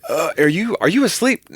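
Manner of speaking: high questioning caller. Retold or imitated. Caller asking on phone.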